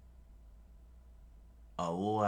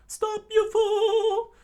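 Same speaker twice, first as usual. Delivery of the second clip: very high, loud, slow scream; shrill drawn-out scream; 12 dB louder, much slower than usual. Acted. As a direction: scream after him, arm reached out. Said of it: "Sanji screamed out".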